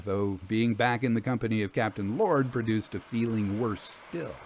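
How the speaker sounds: plain reading voice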